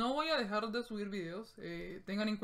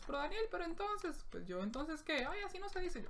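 high, soft, prissy voice; imagined viewer correcting Daniel, high and soft; mock correcting viewer; 7 semitones higher, 7 dB quieter than usual. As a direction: play a picky viewer scolding softly. Imagined viewer correcting his Spanish.

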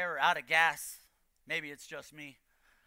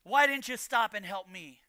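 normal talking voice

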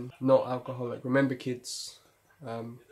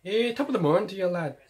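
quick, high mock Irish greeting; bad Irish accent; 7 semitones higher, 4 dB louder than usual. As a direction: toss out a badly faked Irish lilt. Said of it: "Can't really do an Irish accent".

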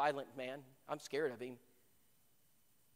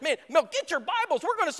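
higher and louder, eager rush; rally your friend to the cause; thrilled excitement; 12 semitones higher, 9 dB louder than usual. Retold or imitated. Young man urging his friend to grab Bibles, thrilled. Thrilled to convert whole church.

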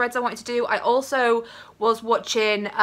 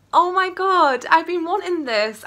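higher, thrilled outburst over a gift; excited; 7 semitones higher, 4 dB louder than usual. Real, not acted. Opening a long-wanted book from a friend, thrilled. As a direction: gasp of real delight.

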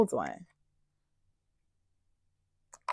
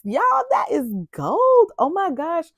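awed gush; 10 semitones higher than usual. Real, not acted. Gushing over foiled notepad.

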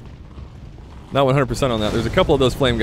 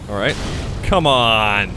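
ordinary talk

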